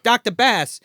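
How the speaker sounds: acted B-movie medical man; earnest coroner. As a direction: pose it as a hammy solemn inquiry. Acting coroner consulting a doctor.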